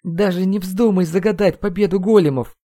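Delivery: high, quavering warning; trembling voice. A narrator's reading. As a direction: plead shakily as an inner voice. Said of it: "обратился я к прошлому себе дрожащим голосом". (высоким дрожащим голосом)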